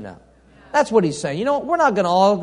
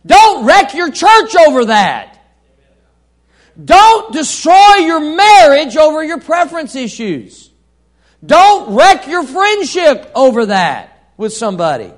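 loud, higher, slow preaching cry; fervent shout; 8 semitones higher, 11 dB louder than usual. Real, not acted. Preacher warning the church not to wreck marriages, shouting. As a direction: thunder the warning at the congregation. Preacher pleading at full force.

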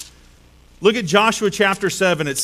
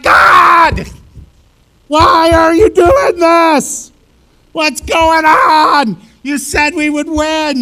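loud, high, slow pleading cry; anguished shout; 9 semitones higher, 8 dB louder, much slower than usual. Retold or imitated. Joshua crying out to God, loud slow anguished plea.

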